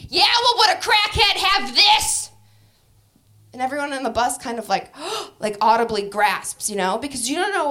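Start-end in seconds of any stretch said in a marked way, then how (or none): 0.1-2.3 high, loud, slow defiant boast; hammed-up challenge to the whole bus; crackhead voice.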